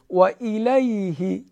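الیه with a clear long-free i, high and slow; Arabic i. Shown as a demonstration. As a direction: say the kasra in الیه as i. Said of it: "کسره رو ای بگیم". (کسرهٔ عربی ای در الیه)

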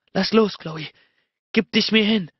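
high, urgent plea; desperate. A narrator's reading. Narrator urging his lover to surrender, desperate. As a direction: urge with rising desperation. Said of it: "Meine Stimme war zittrig vor Verzweiflung".

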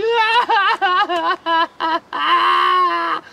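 mock wailing sob. Puppet crocodile wails.

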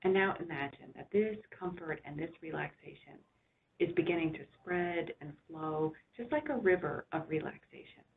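unhurried relaxation voice with long pauses; guided meditation. Real, not acted. Doctor guiding listeners to rest back and relax, soothing guided imagery.

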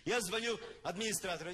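ordinary talk

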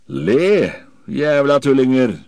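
loud, high-pitched roar; roared command. A narrator's reading. Silver orders thirty pirates to laugh, roaring. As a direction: bellow it out at the whole crew. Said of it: "brølte jeg".

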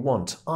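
"want" with a flat, open a. Shown as a demonstration. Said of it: "mówimy jako want".

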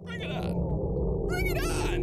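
high, loud, slow taunting call; mock challenge. Real, not acted. Player daring the animatronic to attack, hammed-up taunt. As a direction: dare the monster to come, hamming it up.

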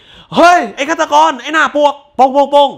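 hammed-up yell; mock shout at killer. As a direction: yell the insult then bang bang. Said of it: "กูคงไม่".

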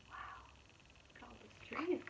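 usual speaking voice

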